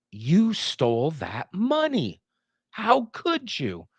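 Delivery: slow, reproachful "You stole that money. How could you?"; disapproving tone. Shown as a demonstration. Teacher acting out disapproval, slow and scandalised. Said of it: "saying it in a disapproving tone".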